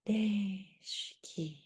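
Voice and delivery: soft, slow breathing guide; guided breathing cues. Shown as a demonstration. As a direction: cue each breath gently and slowly. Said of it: "사 초 한번 해볼게요".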